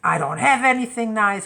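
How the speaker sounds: teen son loudly refusing, mock despair; whiny teen protest. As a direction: whine it like a teenager in crisis. Teen son in major crisis.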